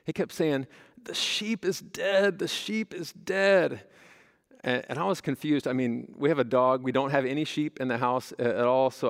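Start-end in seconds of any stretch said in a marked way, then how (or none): 1.0-3.9 high, drawn-out mock wail; retold kid's cry, high and stretched out; imitating bawling child. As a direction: wail slowly like an upset little boy. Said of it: "he's just bawling".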